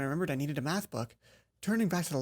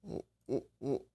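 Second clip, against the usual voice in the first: lost for words, then it bursts out; stammering shock; much slower than usual. Real, not acted. Reacting to the teacher's date, stammer into shocked why.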